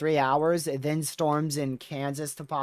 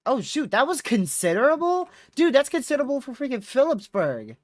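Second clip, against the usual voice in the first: real surprise, pitched way up; astonished; 10 semitones higher, 5 dB louder than usual. Real, not acted. Storm streamer spotting a severe warning in Pennsylvania, astonished. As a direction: react in high-pitched disbelief.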